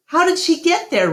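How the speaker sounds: a curious little elephant pressing on; eager child. A narrator's reading. Ruby urgently asks Ivan how, quick and childlike.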